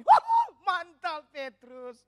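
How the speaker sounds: loud cheering shout to Petrus; friends cheering. Retold or imitated. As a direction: yell it like friends cheering from a boat. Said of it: "Teriaknya begini".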